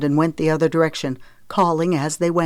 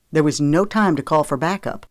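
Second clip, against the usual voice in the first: plain reading voice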